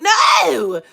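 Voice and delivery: high, loud no; shout. Real, not acted. Telling viewers off, a loud high no. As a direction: shout it down at once. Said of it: "reacting to own story".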